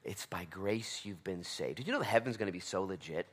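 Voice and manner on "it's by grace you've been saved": slow, intimate whisper. Acted. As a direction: breathe it like a secret. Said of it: "he says".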